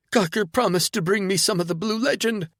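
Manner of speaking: high, loud, unhinged rant; hysterical drugged woman. Acted. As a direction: rave in a manic high voice.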